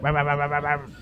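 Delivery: low put-on motorbike revving, held back; mock scrambler engine, far off. Scallies riding scramblers down the street, imitated engine noise. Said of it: "just like".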